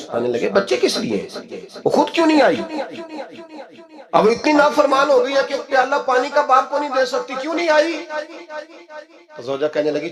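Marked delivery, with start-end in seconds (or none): 0.5-6.0 anger building into a pitched-up question; angry. Retold or imitated. Caliph demands why his daughter did not come, angry and much higher. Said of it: "کو غصہ آیا". 7.4-8.1 much higher sharp demand; angry. Retold or imitated. Caliph demands of his wife why the daughter stayed away, angry high. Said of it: "کو غصہ آیا".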